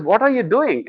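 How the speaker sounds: high, exasperated wife asking again; wife's exasperated voice. Retold or imitated. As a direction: pitch up as the wife loses patience. Wife again, more worked up.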